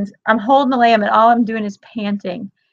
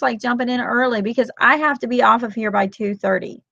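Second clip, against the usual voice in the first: usual speaking voice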